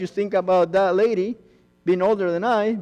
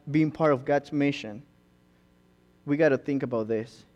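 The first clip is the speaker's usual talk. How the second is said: ordinary talk, nothing marked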